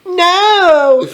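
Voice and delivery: high, drawn-out mock scream. A narrator's reading. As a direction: cry out high and long. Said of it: "I screamed sexily".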